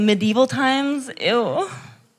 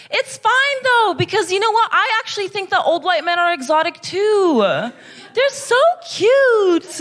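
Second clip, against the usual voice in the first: mock gush; 6 semitones higher than usual. Ironic gushing over old men.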